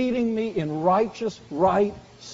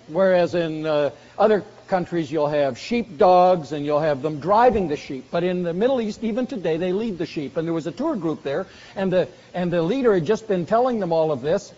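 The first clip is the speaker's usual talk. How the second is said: normal talking voice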